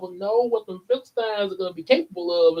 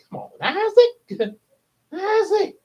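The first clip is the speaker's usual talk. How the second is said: high-pitched mock whine of disbelief; exasperated pleading; 12 semitones higher than usual. Exasperated at Isaac repeating the mistake.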